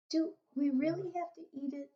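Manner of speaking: put-on little girl voice. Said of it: "Sophie said".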